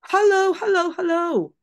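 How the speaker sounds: high, loud sing-song hello; calling parrot voice. Acted. Teacher voicing the mysterious voice from the dining room. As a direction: call it across the hall.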